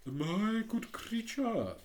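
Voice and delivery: slow, lofty and condescending; grand and disdainful, drawn out; haughty. A narrator's reading. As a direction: look down your nose at her. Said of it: "in a very haughty tone of voice".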